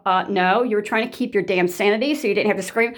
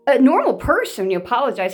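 ordinary talk, nothing marked

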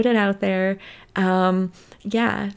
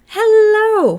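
squealed hello, much higher and louder; 13 semitones higher, 11 dB louder than usual. Shown as a demonstration. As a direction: shriek the greeting. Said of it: "no, that was horrible".

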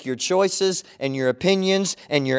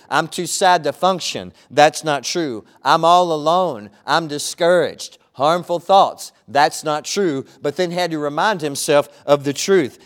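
preaching at full force; 5 dB louder than usual. Acted. Pastor preaching to his church, loud and emphatic. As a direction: proclaim each line to the congregation.

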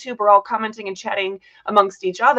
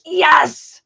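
louder, higher mock cheer of "yes"; hammed-up triumphant exclamation; imitated delight; 7 semitones higher, 5 dB louder than usual. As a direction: act out a co-host's gleeful yes. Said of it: "she's like".

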